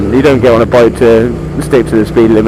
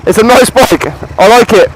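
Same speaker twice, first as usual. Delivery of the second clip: much higher, delighted admiring voice; excited admiration; 10 semitones higher than usual. Real, not acted. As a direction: gush over the bike like a fan. Admiring bike, two takes.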